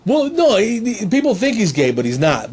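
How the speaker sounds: normal talking voice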